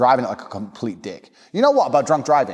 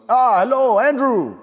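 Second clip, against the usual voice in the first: high, loud greeting in a put-on voice; old party guest; 11 semitones higher, 4 dB louder, slower than usual. Old guy greeting a departing guest, high put-on voice. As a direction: hail him in an imitated old guy's voice. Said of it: "some old guy comes up to me".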